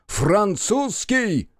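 high, drawn-out proclamation; rising toward a royal acclamation. Acted. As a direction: lift the voice and stretch the word. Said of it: "повышает голос".

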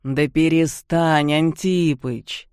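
slow drawn-out whine; whining. Acted. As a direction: whine it out slowly. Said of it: "проныл Серёга".

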